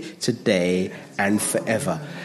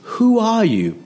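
high-pitched, falling challenge; high swooping who-are-you; incredulous question; 10 semitones higher, 4 dB louder, slower than usual. Acted. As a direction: hit it high, then let it drop. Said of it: "Now, I say it like that".

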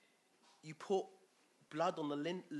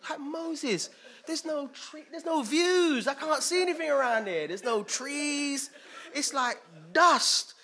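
much higher, louder, slow whining; whiny complaining Israelite; 11 semitones higher, 9 dB louder than usual. Retold or imitated. Israelites moaning to Moses about the desert, whiny and loud. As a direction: moan to Moses like a grumpy tourist. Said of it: "I can imagine them going around and saying".

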